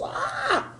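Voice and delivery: high, soft strained "ah"; acted cry of tight muscles; mock pained cry. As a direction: squeeze out a high mock ache. Mimes cold tight muscles hurting.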